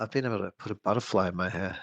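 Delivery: usual speaking voice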